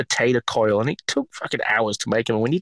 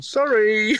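high, drawn-out teasing sorry; hammed-up singsong apology, near laughing; mock apology; 10 semitones higher than usual. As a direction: imitate a colleague's gloating apology. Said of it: "turned around and gone".

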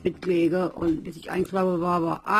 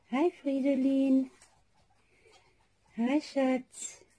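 higher, soft cooing to a pet; 6 semitones higher, 4 dB quieter, much slower than usual. Real, not acted. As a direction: tenderly greet the little one. Greeting the pet. (zärtlich zum Haustier)

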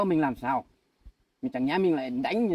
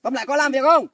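loud, raised demand; angry demand; 7 semitones higher, 9 dB louder than usual. Acted. Husband loudly demanding the maid get back to work. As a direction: bark the question loudly.

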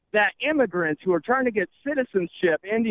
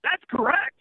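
higher-pitched game-show ruling; mock quiz-show host; 5 semitones higher than usual. Host telling contestant he got it right, high game-show verdict. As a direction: announce a winner in a raised voice.

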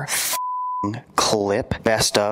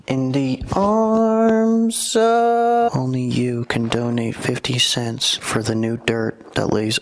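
ordinary delivery

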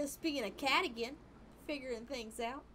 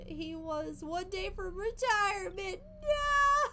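mock crying; 7 semitones higher, 5 dB louder, slower than usual. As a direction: pretend sob building to a wailing high cry on no. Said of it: "You almost started crying?".

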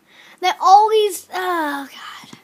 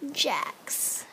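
whisper; 6 semitones lower, 12 dB quieter than usual. Real, not acted. Gamer testing a baby name, whispered.

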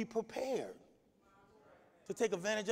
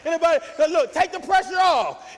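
loud, high shouted preaching; fiery pulpit shout; full-force preaching; 7 semitones higher, 12 dB louder than usual. Real, not acted. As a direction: preach it hard to the congregation.

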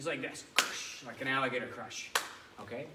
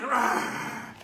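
loud growling roar; lion roar; 10 semitones higher, 10 dB louder than usual. Shown as a demonstration. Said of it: "make that sound like a lion roar".

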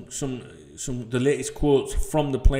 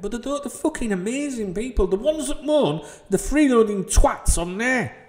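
angry rant; 10 semitones higher than usual. Real, not acted. Ranting about freeloaders.

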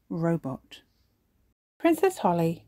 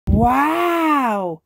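high, loud surprised gasp; a wide-eyed gasp of wonder; the children gasp at the robot arm; gasping wow; 6 semitones higher, 7 dB louder than usual. Acted. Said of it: "the children gasp, surprised".

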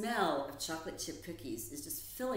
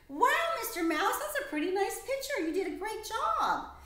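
excited praise; 7 dB louder than usual. Acted. Praising mouse's drawing.

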